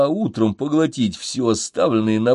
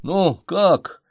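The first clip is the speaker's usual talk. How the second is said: usual speaking voice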